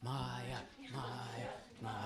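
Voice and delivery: soft calling of a name, fading away; a gentle call that fades to almost nothing; coaxing call. Shown as a demonstration. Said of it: "Just say".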